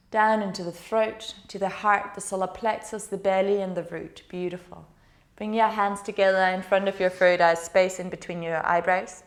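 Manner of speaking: calm, steady meditation voice; guided meditation. Acted. Yoga teacher guiding students through the body, soothing meditation. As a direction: guide the class gently inward.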